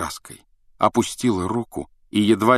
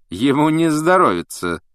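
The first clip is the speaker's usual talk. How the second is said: smirking; 8 semitones higher, 5 dB louder than usual. A narrator's reading. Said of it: "ухмыльнулся Снег".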